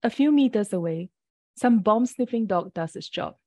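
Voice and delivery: usual speaking voice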